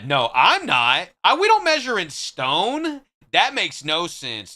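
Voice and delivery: real mock outrage; indignant. Real, not acted. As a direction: refuse the idea outright. Indignant denial about stone.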